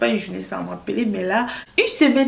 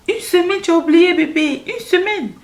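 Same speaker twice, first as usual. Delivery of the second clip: high, loud indignant exclamation; pitched-up protest over a week of silence; outraged disbelief; 9 semitones higher, 5 dB louder than usual. Retold or imitated. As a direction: throw her excuse back at her in disbelief. Indignant at friend's excuse.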